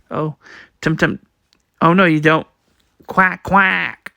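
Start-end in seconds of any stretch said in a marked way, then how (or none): 3.1-4.1 a nasal duck quacking; mock duck quack. Duck sound read aloud.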